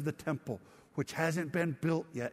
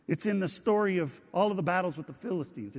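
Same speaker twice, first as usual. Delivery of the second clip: ordinary delivery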